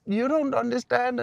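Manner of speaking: high, loud mock protest; hammed-up upset outburst; imitating distressed patient. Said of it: "she did the whole thing over again".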